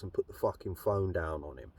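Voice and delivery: ordinary talk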